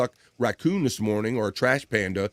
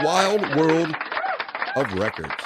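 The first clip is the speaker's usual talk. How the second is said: segment announcer; 5 semitones higher, much slower than usual. Acted. Host repeats the segment name for viewers, announcer style. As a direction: echo the title like a hype man.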